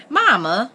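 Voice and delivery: high, loud wail of embarrassment; mock wail. A narrator's reading. Embarrassed Bess protesting to her mother, wailing. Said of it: "Bess wailed, hiding her face".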